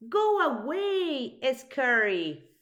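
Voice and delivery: high, loud, slow and defiant; brave shout. A narrator's reading. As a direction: chase the monster off with a loud cry. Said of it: "shouted the small person".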